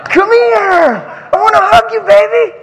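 very high, loud excited call; gushing affection. Real, not acted. Preacher calls a child for a hug, high gushing shout. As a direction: call a little one into your arms.

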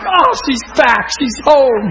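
loud, high, drawn-out exclamation; elated shout. Retold or imitated. Minister seeing the healed woman dancing, elated shout. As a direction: shout it out in overjoyed amazement. Reliving joy at her recovery.

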